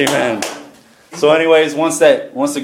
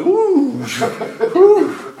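excited woo. Retold or imitated. Recalling the spiking contraction monitor.

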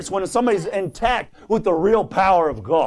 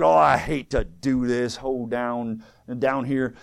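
slow, low grumble fading softer; mock reluctant; 6 semitones lower, 3 dB quieter, slower than usual. Preacher as Naaman wading into the Jordan, reluctant. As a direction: drag it out like an unwilling convert. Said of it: "like it was a sick and an affair".

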